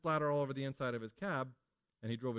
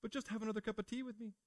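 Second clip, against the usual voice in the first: much higher, softer mock-weepy mom; tearful clingy mother; 10 semitones higher, 6 dB quieter than usual. Mother guilting her child into staying for tea, mock-weepy and high. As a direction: play the mother pretending to cry so the child stays. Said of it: "your mother's gonna say, 'I'm crying'".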